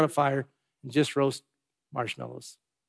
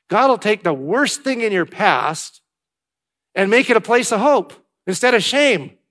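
building, louder and higher toward hope; rising preacher's cry; 5 semitones higher, 6 dB louder than usual. Real, not acted. Preacher to congregation, building to a loud, high cry. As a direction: lift it up like a revival promise.

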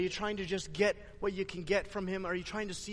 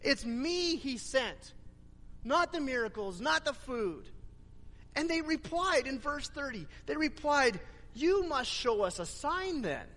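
higher, impassioned sermon delivery; fervent preaching; 7 semitones higher than usual. Acted. Pastor retelling Jesus to congregation, fervent preaching. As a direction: preach the scene out with fire.

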